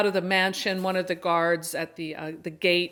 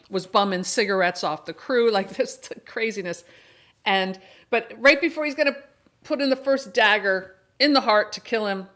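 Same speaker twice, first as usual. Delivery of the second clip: ordinary talk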